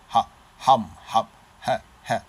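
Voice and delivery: "ha, hum" punched out short and loud; forceful, staccato "ha"; emphatic ha. Shown as a demonstration. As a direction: hit the H in "ha" and "hum" hard, cutting each off. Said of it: "You know, more emphatic".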